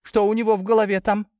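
mother's worried warning. A narrator's reading. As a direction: plead with husband not to go. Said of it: "предупредила мать отца".

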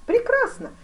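very high, loud, merry cry; merry exclamation. A narrator's reading. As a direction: exclaim it cheerfully, voice leaping up. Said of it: "весело воскликнул великий князь". (весело восклицая)

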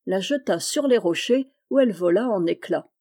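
usual speaking voice

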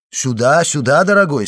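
lively host's welcome; genial journalist greeting a friend. A narrator's reading. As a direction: beckon a dear friend in heartily. Beauchamp greets his visiting friend.

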